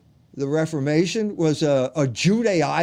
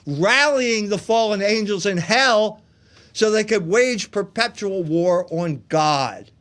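ordinary delivery

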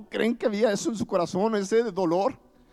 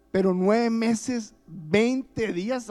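slow, weighty, each word stretched; slow emphasis; 3 semitones higher, much slower than usual. Real, not acted. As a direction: drag out the nine months. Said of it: "como dejándonos claro". (lento y enfático: nueve meses, veinte días)

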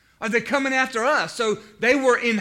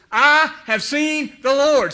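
slow, higher and louder, every word proclaimed; joyful proclamation; 6 semitones higher, 8 dB louder, slower than usual. Acted. Mary announcing to the disciples, slow joyful proclamation. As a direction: announce it like the best news ever.

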